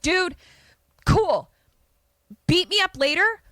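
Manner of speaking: high-pitched, urgent reasoning, acted; exasperated plea. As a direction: plead with the bully to do it later. Acted plea to delay the beating.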